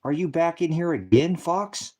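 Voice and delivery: exasperated call-out. Real, not acted. Troll returns to chat.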